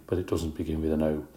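usual speaking voice

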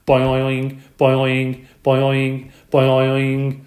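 loud, springy, repeated twang; twanging frog boing. A narrator's reading. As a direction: bounce each boing like a spring. Og twanging again.

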